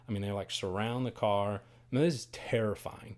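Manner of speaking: ordinary talk, nothing marked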